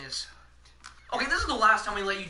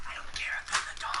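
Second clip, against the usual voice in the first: hushed aside under the chatter; whispering; 5 dB quieter than usual. Real, not acted. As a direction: whisper it to yourself. Aside while others talk.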